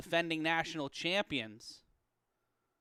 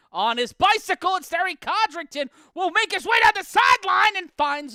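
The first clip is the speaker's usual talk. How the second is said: high, loud breakaway call; play-by-play yell, much higher and louder; excited shout; 12 semitones higher, 13 dB louder than usual. Real, not acted. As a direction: shout the run as it breaks open. Big run down the sideline.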